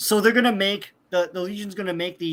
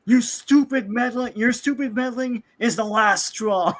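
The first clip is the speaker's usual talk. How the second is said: mock angry rant; 6 semitones higher than usual. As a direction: scold them like a pompous hero. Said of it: "they get really super angry".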